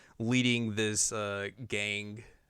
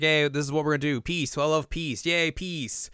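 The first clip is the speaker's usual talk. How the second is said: higher, light-hearted mock speech; cheery proclamation; 6 semitones higher than usual. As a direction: proclaim it like a happy new ruler. Acting the new ruler's happy speech.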